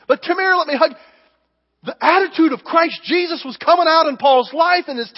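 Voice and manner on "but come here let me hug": inviting hug. Retold or imitated. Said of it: "Then he says".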